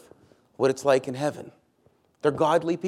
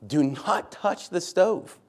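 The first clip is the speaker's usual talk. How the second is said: "Do not touch the stove" drawn out slowly and pitched higher; slow, higher stress on every word of "Do not touch the stove"; underlined emphasis; 4 semitones higher, much slower than usual. Shown as a demonstration.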